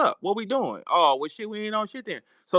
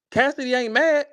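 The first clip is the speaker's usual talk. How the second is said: ordinary talk, nothing marked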